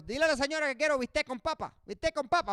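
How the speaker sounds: fast, high Spanish order; imitating mom. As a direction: rattle off the order. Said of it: "My mom would want".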